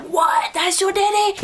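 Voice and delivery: high, incredulous question; mock-stunned squeal; shocked disbelief. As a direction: act out shock at a reveal, pitch jumping up. Acting out her shocked reaction.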